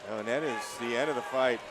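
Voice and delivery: usual speaking voice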